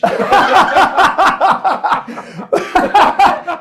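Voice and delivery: big high burst of laughter; high loud laugh. Real, not acted. Laughing at his own thuggish haircut.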